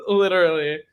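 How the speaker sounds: word squeezed through laughter; laughing. Real, not acted. Laughing while speaking.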